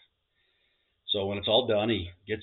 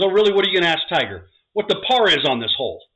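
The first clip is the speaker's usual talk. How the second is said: higher and much louder, indignant; challenging wife; 9 semitones higher, 11 dB louder than usual. Acted. Bride challenging her husband, loud and high. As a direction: fire it back at him. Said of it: "She goes".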